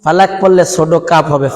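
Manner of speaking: normal talking voice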